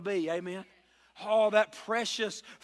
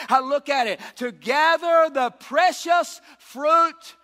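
preacher climbing to a roar; full-force preaching; 4 semitones higher, 7 dB louder than usual. Acted. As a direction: build it up and shout the verse.

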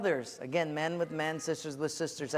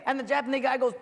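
ordinary delivery

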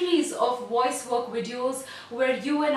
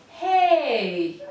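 full open call, louder; projected hey; 4 dB louder than usual. Shown as a demonstration. Coach leading the class in a thrown hey. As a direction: throw the hey loud across the room. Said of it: "as you breathe out, I want you to say".

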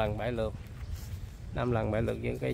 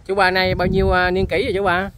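loud, raised-pitch question across a distance; calling out; 5 semitones higher, 10 dB louder than usual. Real, not acted. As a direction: call it out to someone farther off. Calls question to man off-mic. (gọi to hỏi vọng sang)

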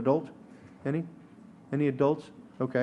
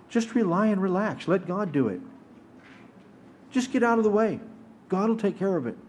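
higher-pitched, breezy imitation of easy advice; dismissive mock quote; 5 semitones higher than usual. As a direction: lightly parody carefree, hands-off reassurance. Quoting advice the preacher rejects.

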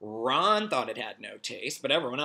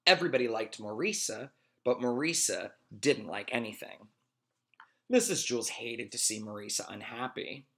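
ordinary delivery

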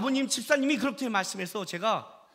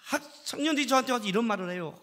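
normal talking voice

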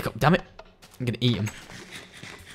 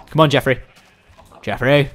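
normal talking voice

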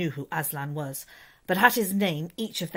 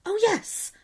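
excited cry. A narrator's reading. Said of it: "they all cried".

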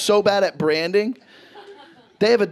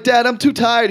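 higher, louder plea of a tired son; whining kid; 6 semitones higher, 5 dB louder than usual. Acted. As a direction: plead with dad as a worn-out kid. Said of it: "I'm like".

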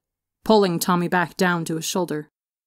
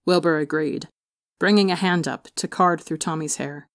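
ordinary delivery

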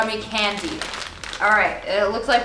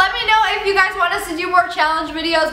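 higher, peppy sign-off voice; upbeat vlog outro; 8 semitones higher, 3 dB louder than usual. Acted. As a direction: sell the subscribe ask cheerfully.

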